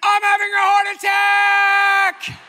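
slow, high, loud mock scream; hammed-up panicked yell into the ER. Bursting into the ER, screaming a hammed-up heart attack. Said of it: "and I scream".

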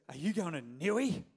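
lightly put-on yobbo Australian accent. Friend asking the pastor about the trip, mild yobbo Australian. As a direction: imitate a broad yobbo Aussie. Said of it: "really yobbo Australian".

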